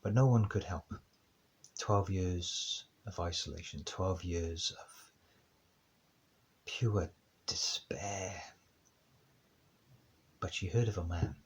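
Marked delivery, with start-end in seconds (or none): 1.8-8.4 slow and heavy. Acted. Slow dramatic sermon emphasis.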